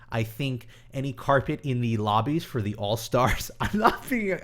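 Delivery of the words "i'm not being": breaking into a laugh mid-sentence, pitched higher; real laughter spilling into the words. Real, not acted. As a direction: giggle through the words, caught off guard by his own answer. Laughs about carpet answer.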